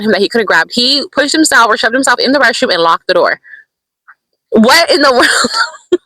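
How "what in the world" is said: high, slow, breaking into laughter; laughing disbelief. Real, not acted. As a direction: laugh through the words in disbelief.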